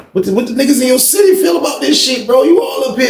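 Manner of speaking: amped-up prying question; worked-up question. Retold or imitated. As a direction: press him hard for the dirt. Pressing friend about his city.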